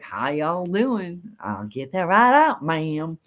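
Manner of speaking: Kentucky restaurant server's slow drawl; heavy Southern drawl. Retold or imitated. Kentucky server taking the family's order, heavy Southern drawl. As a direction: stretch it out in a thick Southern accent. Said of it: "It was such a heavy drawl".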